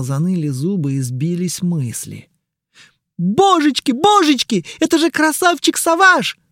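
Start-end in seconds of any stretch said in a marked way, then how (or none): 3.2-6.4 high, loud excited squeal; squealing fangirl. Acted. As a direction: shriek it like a starstruck fan. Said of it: "верещала".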